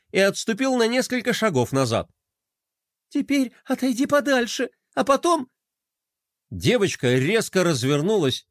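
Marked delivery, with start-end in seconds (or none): 3.1-5.5 high, nervous instruction; trembling voice. A narrator's reading. Shocked girl sending brother further away, trembling. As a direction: direct warily in a high, shaken voice. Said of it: "дрожащим голосом попросила она".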